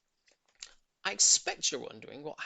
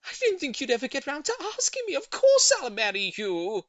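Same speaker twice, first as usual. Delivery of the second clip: much higher, louder, overjoyed acceptance; delighted; 11 semitones higher, 10 dB louder than usual. Acted. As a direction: gush with happy relief. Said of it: "she cried".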